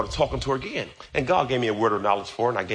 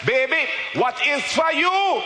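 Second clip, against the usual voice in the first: put-on high, shouted Jamaican accent, preached; mother's Jamaican accent; 13 semitones higher, slower than usual. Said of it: "my mother would say in her Jamaican accent".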